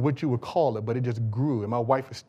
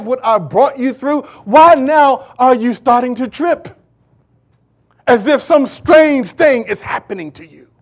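building into a loud, high, incredulous challenge; God's challenge, preached; 10 semitones higher, 15 dB louder than usual. Acted. Speaking as God to worried believers, building to loud challenge. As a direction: start measured, then thunder each question higher and louder. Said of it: "he's really saying".

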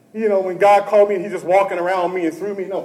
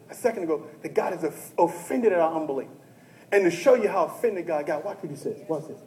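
ordinary delivery

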